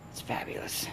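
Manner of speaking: whispered mock answer; hushed fabulous. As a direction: say it under your breath. Wife's reply, voiceless.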